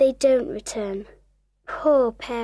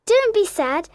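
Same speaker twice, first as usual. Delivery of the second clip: high, consoling invitation; Wendy; 5 semitones higher than usual. Acted. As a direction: cheer them up in a raised pitch. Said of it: "says Wendy".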